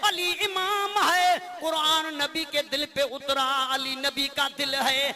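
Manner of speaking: chanted couplets with stretched endings; declaimed devotional verse. Acted. Reciter pairing Quran and Ali for listeners, devotional chant. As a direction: declaim paired praises in a measured lilt. Recites paired praise lines.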